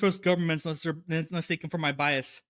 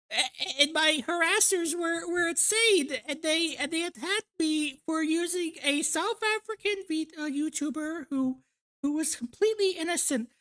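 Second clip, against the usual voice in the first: much higher cute anime voice; squeaky high anime girl; 10 semitones higher than usual. Retold or imitated. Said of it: "They do the anime girl voice".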